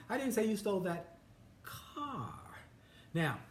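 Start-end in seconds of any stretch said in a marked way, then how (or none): 0.1-2.3 "car" held back by a pause, then high and falling; quick lead-in, then a separate, high, falling "car"; stress on CAR. Shown as a demonstration. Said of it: "Emphasis on different words using the same sentence".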